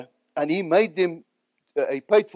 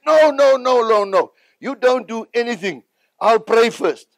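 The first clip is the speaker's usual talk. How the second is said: high, loud, insistent no, no, no; quoting Reinhard refusing; 6 semitones higher, 4 dB louder than usual. Retold or imitated. Reinhard turning down a friend's plea, high insistent refusal. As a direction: wave him off firmly, pitched up.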